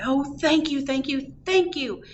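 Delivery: high, excited thank-yous; gushing thanks. A narrator's reading. Grateful family thanks the girl.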